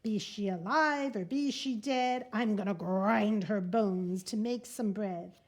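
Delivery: loud, heavy giant chant, each word pounded out; booming giant. Acted. Giant sniffing out the hidden girl, booming chant. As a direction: boom it like a greedy giant sniffing the air.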